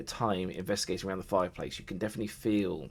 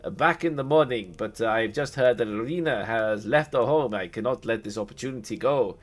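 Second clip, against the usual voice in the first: higher, louder, courtly but rushed; hasty vampire lord; 4 semitones higher, 6 dB louder than usual. Acted. Strahd apologises to his guests before chasing Irina, courtly haste. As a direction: politely excuse yourself, itching to leave. Strahd excuses himself hastily.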